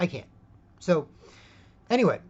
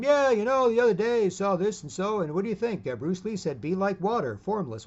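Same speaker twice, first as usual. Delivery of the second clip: chatty gym guy; 6 semitones higher, 4 dB louder than usual. Retold or imitated. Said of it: "They're focused on".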